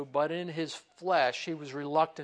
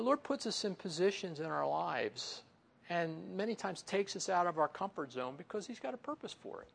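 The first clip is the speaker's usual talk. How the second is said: usual speaking voice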